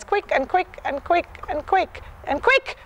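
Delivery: "quick" said short and snappy each time; brisk and clipped, like short collected canter strides; quick clipped rhythm. Shown as a demonstration. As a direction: rap out "quick and quick" in a tight beat. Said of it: "And when collecting".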